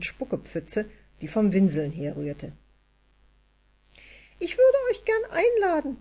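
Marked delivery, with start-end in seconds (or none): 4.4-6.0 high-pitched little elf, eager; high elf voice, inviting eagerly; hurried elf voice. A narrator's reading. Elida hurriedly invites Anja to Christmas, high elf voice. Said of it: "fuhr Elida eilig fort".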